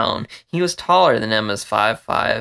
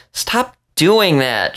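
slow, drawn-out complaint, high and louder; complaining; 7 semitones higher, 5 dB louder, much slower than usual. Acted. Emma complaining to Vince, slow and whiny. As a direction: drag out the protest. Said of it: "she complained".

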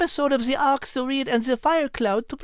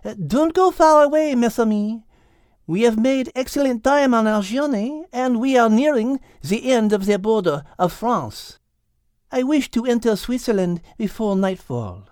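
put-on French accent; 3 semitones lower, 4 dB louder than usual. Liz French cat.

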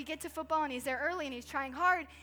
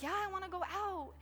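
son insisting; 5 semitones higher than usual. Retold or imitated. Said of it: "And he's like".

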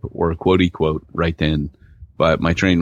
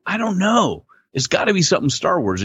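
high, emphatic retold outburst; acted-out exasperation, pitched up; exasperated retold reply; 10 semitones higher than usual. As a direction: throw it out high and insistent. Retelling his reply to wife.

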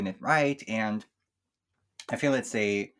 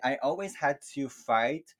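ordinary delivery

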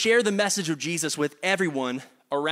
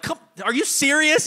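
high, louder exasperated mom; mom in disbelief; 10 semitones higher, 4 dB louder than usual. Retold or imitated. Mom sees dad with chocolate teeth, high disbelief. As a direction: shoot it up in shocked disbelief. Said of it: "mom's like".

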